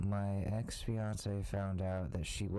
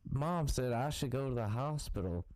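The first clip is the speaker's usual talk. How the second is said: higher-pitched mock rube; gullible patient; 7 semitones higher than usual. Comedian as a poisoned rube distrusting doctors, naive patient. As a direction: plead like a naive patient in a comic bit.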